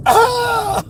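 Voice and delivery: very high, loud mock shriek; imitated frightened scream. Driver re-enacts customer seeing him jump out, mock scream. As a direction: let out a pretend startled scream.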